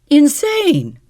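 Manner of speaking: high, loud indignant cry; outraged cry. A narrator's reading. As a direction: fling the word back at him. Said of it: "she cried".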